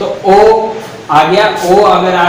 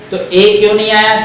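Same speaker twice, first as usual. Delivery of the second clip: ordinary delivery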